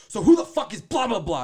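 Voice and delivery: high, loud shrill-edged accusation; mock angry girlfriend. Girlfriend confronting him as he walks in, loud high accusation.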